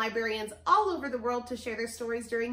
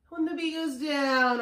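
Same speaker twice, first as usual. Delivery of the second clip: long falling glide on "down"; loud, descending swoop through "down"; voice going down; 3 semitones higher, 6 dB louder than usual. Shown as a demonstration. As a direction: start high and slide down to "down". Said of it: "our voice has to go down".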